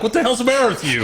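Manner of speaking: high, loud, fast appalled scolding; appalled retort. Retold or imitated. Son scolding catcalling dad, high fast appalled cry. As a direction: snap at dad in disbelief. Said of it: "look at him like".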